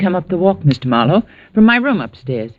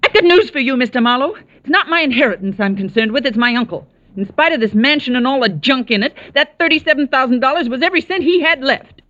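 high-pitched, heated rebuttal; indignant; 9 semitones higher than usual. Acted. Niece defends concern for her uncle, indignant. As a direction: set him straight, voice pitched high throughout.

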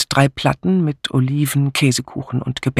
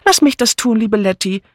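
high, eager calling out; fluttery high-pitched offer; eager older companion; 9 semitones higher, 3 dB louder than usual. A narrator's reading. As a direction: jump in pleading to help. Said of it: "rief Miss Banner".

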